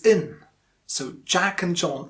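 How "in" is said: and said as a short in; weak form of and. Shown as a demonstration. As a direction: say in, the weak form of and, with no full vowel. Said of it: "it is".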